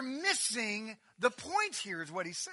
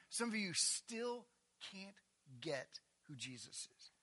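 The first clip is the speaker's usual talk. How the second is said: ordinary talk, nothing marked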